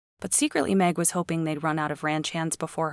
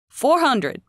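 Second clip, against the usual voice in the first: loud, higher auctioneer exclaiming; exclaimed announcement; 9 semitones higher, 7 dB louder than usual. Acted. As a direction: announce it with a thrill. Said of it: "Billy exclaimed".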